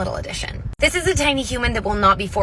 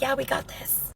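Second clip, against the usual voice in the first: much softer, easy reassurance; playing it cool; 3 semitones higher, 9 dB quieter than usual. Mom hiding her excitement from her daughter, soft and cool. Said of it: "I'm chill, I'm cool".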